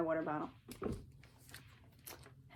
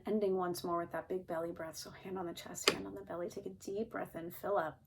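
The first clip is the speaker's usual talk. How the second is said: calm grounding exercise. Shown as a demonstration. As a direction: gently lead the last breath. Closing breath exercise.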